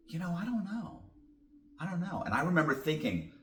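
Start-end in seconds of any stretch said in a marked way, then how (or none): 0.1-2.2 higher and softer, a hesitant shrug in the voice; mildly voiced puzzled detective, softer; unsure detective. Detective admits to the suspect he has no idea, higher and softer.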